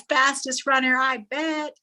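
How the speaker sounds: high-pitched sarcastic jeer; mock him like a laughing crowd; making fun. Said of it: "they're just making fun of him".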